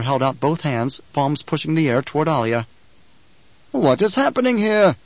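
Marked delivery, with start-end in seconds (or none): 3.7-5.0 sharp imperial demand; demanding emperor. A narrator's reading. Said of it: "the Emperor demanded".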